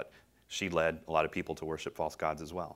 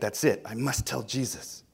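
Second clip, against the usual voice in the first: retold joyful cry; 6 semitones higher than usual. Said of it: "she cried".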